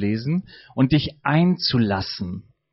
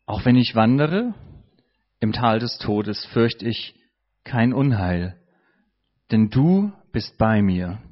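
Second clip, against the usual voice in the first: low, slow psalm reading with pauses; slow meditative reading; 5 semitones lower than usual. Shown as a demonstration. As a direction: read the verse slowly, listening for one word. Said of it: "was ist das Wort in diesem Vers".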